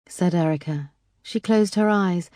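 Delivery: ordinary delivery, nothing marked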